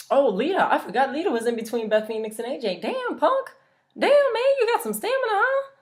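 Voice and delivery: excited squeal. Real, not acted. Excited aside about Lita and Punk.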